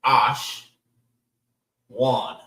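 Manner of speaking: Ash and Wan as separate sounds; teacher sounding out Ash... Wan slowly; syllable by syllable. Shown as a demonstration. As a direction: split Ash, Wan with a long pause between. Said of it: "Three different sounds".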